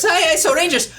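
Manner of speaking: high, quick robot sidekick; Alpha's voice. Acted. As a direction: chirp it high like a little robot. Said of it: "you kinda hear in the intercom Alpha's voice".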